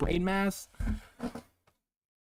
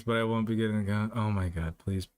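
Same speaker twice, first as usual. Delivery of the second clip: ordinary talk, nothing marked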